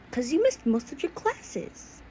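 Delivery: high, slow, drawn-out reproach; chiding teacher. A narrator's reading. Teacher to a pupil who skipped classes, chiding. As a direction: stretch it out high like a scolding teacher.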